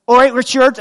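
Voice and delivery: chirpy Bristol "All right, Richard"; Bristol accent. Retold or imitated. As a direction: Bristol neighbour's hello, lightly done. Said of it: "that's why the accent".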